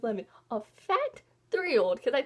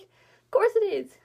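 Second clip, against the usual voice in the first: mock indignant; 7 semitones higher, 6 dB louder than usual. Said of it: "I was like".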